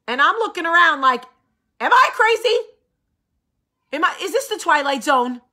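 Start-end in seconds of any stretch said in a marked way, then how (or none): 1.8-5.4 high, loud am I crazy; disbelief. Retold or imitated. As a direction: act baffled by everyone. Incredulous at the reactions.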